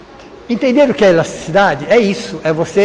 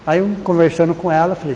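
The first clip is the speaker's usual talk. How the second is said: ordinary talk, nothing marked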